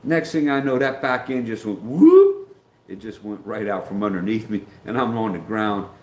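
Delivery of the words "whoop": high, loud rising whoop; drawn-out sound effect of a bike slipping away; imitated whoop. Imitates bike sliding out from under him.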